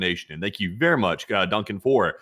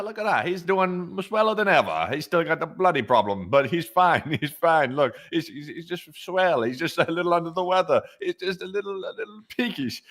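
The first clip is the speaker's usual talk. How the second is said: much higher hammed-up British voice; hammed-up British chap, higher; mock British keeper; 9 semitones higher than usual. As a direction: fuss over the marmot like a cockney. Plays British marmot keeper.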